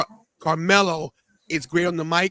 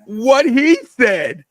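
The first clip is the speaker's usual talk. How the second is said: slow, loud, higher hype; emphatic endorsement; 5 semitones higher, 9 dB louder, much slower than usual. Real, not acted. Backing the little guest's sign-off, loud and emphatic. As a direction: belt it out, stretching each word.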